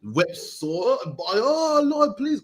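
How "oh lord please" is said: high, wailing plea to the Lord, much higher; imitating a sobbing king's cry; mock crying. Hezekiah begging the Lord, imitated bawling. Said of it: "crying, man".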